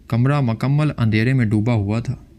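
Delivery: usual speaking voice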